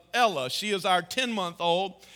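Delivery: ordinary talk, nothing marked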